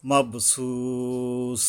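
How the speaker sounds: মাবসুস with a very long, level su; three-alif stretch. Shown as a demonstration. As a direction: chant মাবসুস slowly, holding the সু. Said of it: "তিন আলিফ টানতে হবে". (মাবসুস-এর সু তিন আলিফ টেনে পড়া)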